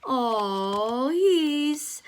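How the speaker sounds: usual speaking voice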